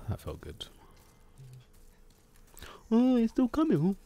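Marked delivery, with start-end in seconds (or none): 2.9-4.0 very high sneering squeak; mock the enemy in a silly high voice; taunting high impression. Mocking enemy voice again.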